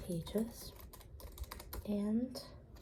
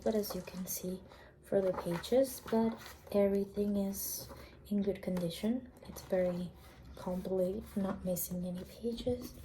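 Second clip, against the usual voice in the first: ordinary talk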